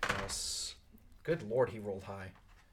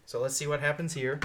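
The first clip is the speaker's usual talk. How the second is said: ordinary talk, nothing marked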